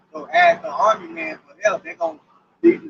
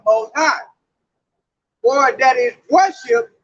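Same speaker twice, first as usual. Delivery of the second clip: slow, solemn scripture read; 4 semitones lower than usual. Acted. Preacher reading Thessalonians aloud to the brethren, slow and solemn. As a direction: declaim it from the pulpit.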